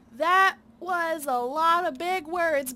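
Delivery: loud, slow mock-dumb reply; loud slow playful mockery; mock bewilderment. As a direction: drag it out, pretending to be lost. Mocking the big words.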